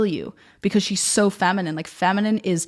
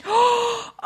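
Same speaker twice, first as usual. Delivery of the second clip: quick high gasp of shock; sharp gasp. Real, not acted. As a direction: catch your breath in amazement. Opens the book, finds the quote.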